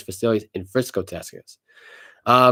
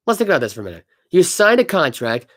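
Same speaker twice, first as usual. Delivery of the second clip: ordinary talk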